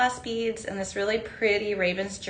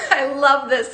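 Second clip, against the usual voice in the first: laughing; 2 semitones higher, 8 dB louder than usual. Real, not acted. Reading viewers' hometowns live.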